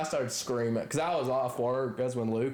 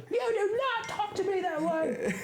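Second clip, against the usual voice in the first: much higher, haughty squeak; mock indignant lady. Imitating the neighbor scolding him, high and haughty. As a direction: parody a prim scolding. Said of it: "She goes".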